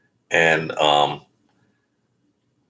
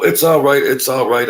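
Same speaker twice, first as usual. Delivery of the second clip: hurried placating; 6 semitones higher, 5 dB louder than usual. Retold or imitated. Retold hasty placating of quarrelling men.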